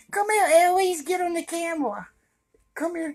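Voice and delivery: sing-song high call to a shy dog; high pet-talk voice. Real, not acted. Calling his dog to the camera.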